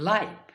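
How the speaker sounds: লাইফ with an eye diphthong; লাইফ rhyming with five; adjective pronunciation. Shown as a demonstration. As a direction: say লাইফ as laiv. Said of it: "উচ্চারণ হবে".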